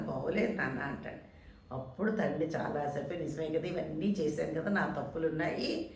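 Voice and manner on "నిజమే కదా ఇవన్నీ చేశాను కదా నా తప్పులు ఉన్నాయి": soft, lowered voice of a father admitting fault; quiet regret. Retold or imitated. Father quietly admitting his mistakes to his son, soft regretful voice. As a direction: murmur it as if to himself.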